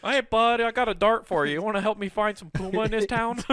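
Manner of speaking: goofy Canadian asking a buddy; Canadian accent. Acted. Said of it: "random Canadian".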